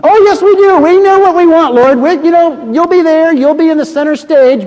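brash sons insisting they know what they want; eager insistence. Acted. Brothers eagerly assure Jesus they can handle it, high and pushy.

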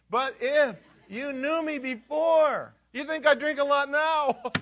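high, loud, comic self-defense; hammed-up indignant dinner guest; mock protest.

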